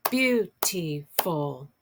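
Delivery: "Beautiful" stretched slowly, syllable by syllable; "Beautiful" in three slow, separate beats; syllables clapped out. Shown as a demonstration. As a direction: draw out "Beautiful" beat by beat. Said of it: "clapping out syllables".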